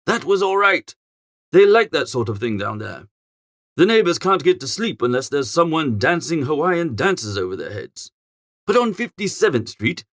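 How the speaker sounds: plain reading voice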